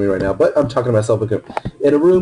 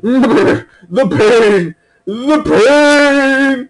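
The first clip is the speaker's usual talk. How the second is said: hammed-up high, loud wail of pain; mock agony; 11 semitones higher, 4 dB louder, much slower than usual. Gamer voicing a hit enemy, hammed-up wails of pain. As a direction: overplay a dying cry, higher each time.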